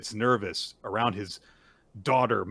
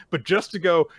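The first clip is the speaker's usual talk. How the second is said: usual speaking voice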